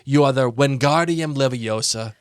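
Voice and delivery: normal talking voice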